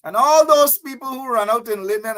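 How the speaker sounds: ordinary delivery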